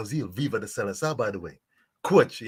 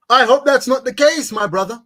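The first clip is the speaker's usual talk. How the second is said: loud plea; 8 semitones higher, 10 dB louder than usual. Real, not acted. Pleading to guest, high loud.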